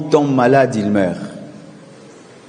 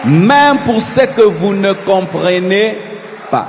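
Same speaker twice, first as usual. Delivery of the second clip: preaching at full force; 10 semitones higher than usual. Acted.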